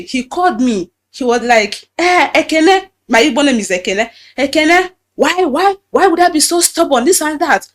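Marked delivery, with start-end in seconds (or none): 2.0-2.9 higher, louder call of her name; imitating the pastor. Pastor calling Ekene over the phone, raised scolding call. 4.4-7.7 higher and louder, scolding her as stubborn; mock scolding. Pastor scolding Ekene for being stubborn, higher raised voice.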